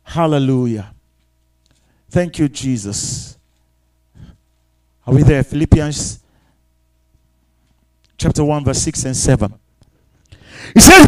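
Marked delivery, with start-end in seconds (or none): none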